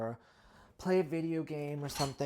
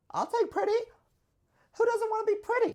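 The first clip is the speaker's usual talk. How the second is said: much higher, louder gleeful cry; gleeful squeal; 8 dB louder than usual. Real, not acted. Claiming the compliment to viewers in a high, loud, playful squeal. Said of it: "And I'll take pretty".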